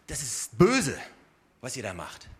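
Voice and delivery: „böse“ kräftig betont, danach tief und leise auslaufend; Betonung auf „böse“. Shown as a demonstration. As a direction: stress „böse“ as a moral verdict, then drop away. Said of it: "die Betonung von Jeremia liegt gar nicht mal darauf". („böse“ mit Nachdruck aussprechen, den Rest fallen lassen)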